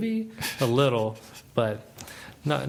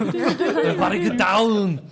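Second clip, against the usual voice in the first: loud, slow action-hero yell through a laugh; hammed-up movie command, laughing; laughing mock action-hero shout; 7 semitones higher, 5 dB louder, slower than usual. Joking Arnold-style action lines.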